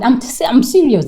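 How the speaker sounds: normal talking voice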